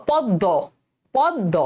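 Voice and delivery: পদ্ম split as "pod-do", m silent; doubled d. Shown as a demonstration. Teacher asking students to hear it, পদ্ম with doubled d. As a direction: land twice on the d of পদ্ম. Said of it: "দয়ের উচ্চারণ কি দুইবার পাচ্ছ".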